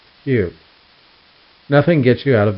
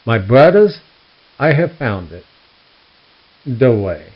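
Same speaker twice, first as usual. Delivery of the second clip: loud grand proclamation; proclaiming to followers; 6 dB louder than usual. Acted. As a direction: announce it like a prophet. Preacher declaring to followers.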